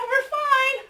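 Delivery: high, nervous acted reassurance; nervous self-reassurance. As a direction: act out trying to stay calm. Nervous self-reassurance after lightning realization.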